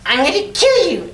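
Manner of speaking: high, loud shrieking goblin threat; bloodthirsty cackling goblin. Acted. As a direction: scream the threat like a crazed goblin. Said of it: "Gotta get goblin voice".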